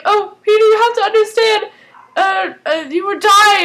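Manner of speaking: high, loud mock-tearful plea; Walter pleading tearfully. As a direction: imitate a distraught father begging to be understood. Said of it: "Walter was, like, crying".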